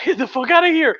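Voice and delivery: high, shocked mock disbelief; incredulous Lenin. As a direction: squeal it out in amazed disbelief. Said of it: "And Lenin is like".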